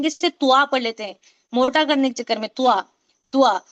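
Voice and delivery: "तुआ" said with rounded lips as tu-a, the mistake; wrong rounded tua. Shown as a demonstration. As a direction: round the lips and split "तुआ" into two sounds. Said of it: "तो ये तुआ नहीं है".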